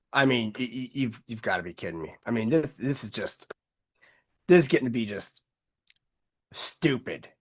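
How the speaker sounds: muttering under breath in disbelief; exasperated, half to yourself; quiet exasperated mutter. Real, not acted. Exasperated at news clip, muttering.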